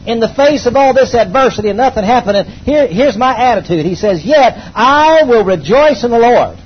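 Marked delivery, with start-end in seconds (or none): 0.0-3.8 preaching. Acted. Pastor declaring to the congregation, emphatic preaching. 4.2-6.6 high, loud, slow proclamation; fervent scripture. Acted. Pastor proclaiming the prophet's words, fervent and loud.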